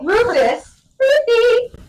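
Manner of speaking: high, loud English name-calling; imitated British accent. Naming little brother from English family, high mock British accent. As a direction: exaggerate the name in shrieky British. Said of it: "speaking in this goddamn fucking accent".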